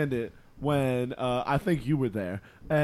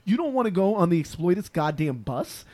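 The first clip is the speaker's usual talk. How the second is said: high, rising incredulous outrage, cracking; retold outrage; 5 semitones higher than usual. Friend won't share his coke, rising to a high incredulous shriek. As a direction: build to a disbelieving shriek. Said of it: "I'm like".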